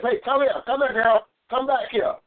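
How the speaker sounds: high urgent call. Retold or imitated. Preacher calling the wife back, urgent high call. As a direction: beckon her over, high.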